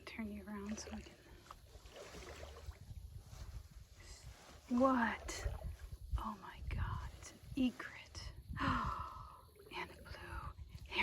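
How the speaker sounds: breathless awed whisper. Real, not acted. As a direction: whisper so the birds stay. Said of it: "I don't know why I'm whispering".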